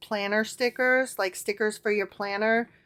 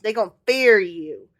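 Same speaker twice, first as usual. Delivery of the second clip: put-on Southern accent; 4 dB louder than usual. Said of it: "[name] continues in his southern accent".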